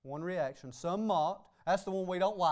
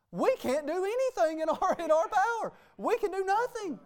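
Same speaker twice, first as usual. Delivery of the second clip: higher and louder, climbing to a peak on nothing; rising preaching cry; 9 semitones higher, 3 dB louder than usual. Acted. Preacher to congregation, on God's power: higher, louder climb. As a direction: preach it up, pitch climbing line by line. Preacher's climax on human weakness.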